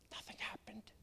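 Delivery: hushed whisper for effect; dramatic whispered aside. Real, not acted. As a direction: drop to a whisper. Dramatic pause in testimony.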